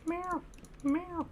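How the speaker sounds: high, evenly spaced little meows; small high-pitched mock meows; imitated cat meows. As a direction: mimic a cat calling from across the house. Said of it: "I hear this meowing".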